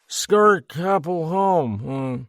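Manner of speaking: put-on high, slow, slurred drunken voice; drunk party guest. A narrator's reading. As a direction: play a grinning drunk. Drunk man rambling.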